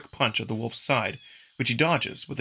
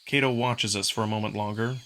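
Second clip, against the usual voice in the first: plain reading voice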